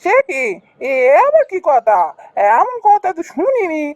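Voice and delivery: high-pitched theatrical protest; incredulous busybody villager, high voice; astonished local customer. Acted. Customer protests at unpriced meal.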